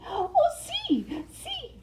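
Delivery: very high, breathless yes; delighted Mariposa. Acted. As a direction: squeal a happy yes.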